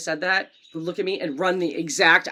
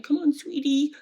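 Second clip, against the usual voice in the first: mock sweet dad; 6 semitones higher, 4 dB quieter, slower than usual. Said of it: "Sweetie? You never go".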